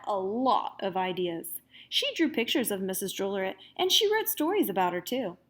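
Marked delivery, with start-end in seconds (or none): none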